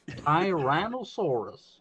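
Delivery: put-on slow drawn-out American accent; thick American accent. Student imitating a classmate's thick American accent, slow. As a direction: say it like a Texan American. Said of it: "thick American accent".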